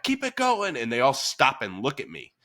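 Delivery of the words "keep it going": high shouted keep it going; yelled encouragement. Retold or imitated. Coach yelling to the class during conditioning circuit. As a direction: call it out to push the class. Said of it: "every now and then I'm like".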